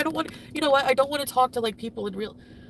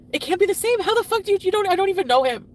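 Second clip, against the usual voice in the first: high, loud agitated outburst; distraught anger; 7 semitones higher, 5 dB louder, faster than usual. Real, not acted. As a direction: let disbelief boil over into anger. Distraught anger about unrecognisable husband.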